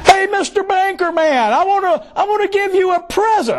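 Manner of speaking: mock sweet debtor. Debtor sweet-talking the banker, high mock voice. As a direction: coax the banker, pitched high.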